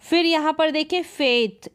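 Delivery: ordinary delivery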